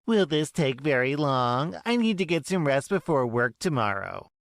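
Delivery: demanding Karen, impatient; impatient mother. Acted. Karen mother speaking in story.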